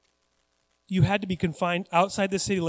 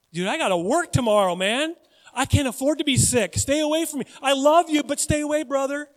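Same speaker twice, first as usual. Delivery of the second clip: keep-away plea; 6 semitones higher, 4 dB louder than usual. Acted. Acts Dwayne avoiding a sick friend.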